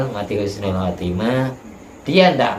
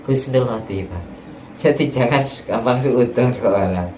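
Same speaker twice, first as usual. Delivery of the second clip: ordinary talk, nothing marked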